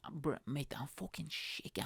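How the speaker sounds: plain reading voice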